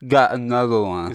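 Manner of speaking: said while holding the tongue; holding tongue with fingers. Shown as a demonstration. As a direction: pinch your tongue and say it. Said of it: "you're supposed to hold your tongue and say".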